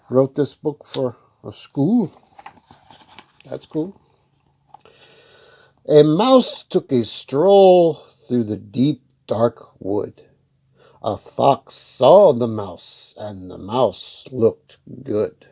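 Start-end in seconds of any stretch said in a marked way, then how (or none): 5.9-15.5 put-on slow, loud read-aloud voice for kids; story narration. A narrator's reading. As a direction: tell it slowly and clearly to children.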